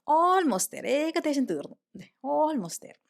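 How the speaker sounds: put-on high-pitched, drawn-out reassurance to a hungry child; mother calling back. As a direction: call back that it's nearly ready. Mother answers her hungry child.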